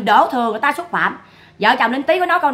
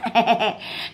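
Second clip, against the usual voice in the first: mocking laugh; 5 dB quieter than usual. Real, not acted. Laughing at opponents.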